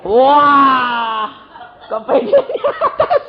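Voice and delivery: high, laughing mock amazement; amused exclaim; laughing tease. Real, not acted. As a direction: tease the crowd for wanting the top. Preacher teases congregation's answer.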